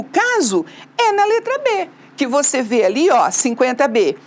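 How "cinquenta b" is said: ordinary talk, nothing marked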